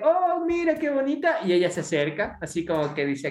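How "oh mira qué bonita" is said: cooing customer. Retold or imitated. Customer voice admiring cat.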